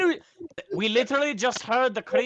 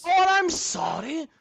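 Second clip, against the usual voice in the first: sarcastic; 9 semitones higher, slower than usual. Acted. Sarcastic mock apology in character.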